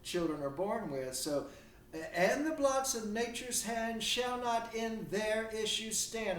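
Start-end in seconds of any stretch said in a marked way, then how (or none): 1.9-6.4 slow, raised quoted verse with weight on "their"; stress on "their". Shown as a demonstration. Lecturer quoting Shakespeare to students, stress on "their". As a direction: recite it higher and drawn out, leaning on "their". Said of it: "I want to emphasize that word".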